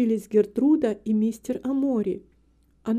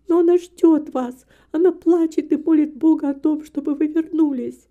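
higher, louder urging; earnest pleading; 4 semitones higher, 6 dB louder than usual. A narrator's reading. Daughter begs reluctant father to return, earnestly. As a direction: plead with him to come.

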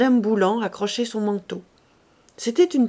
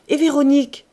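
loud, higher questioning call; 6 semitones higher, 7 dB louder than usual. A narrator's reading. Girl calls to the arriving pair from the steps, crying out. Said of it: "cria-t-elle du perron".

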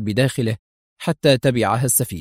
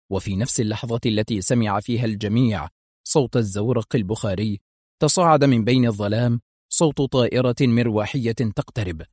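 ordinary delivery, nothing marked

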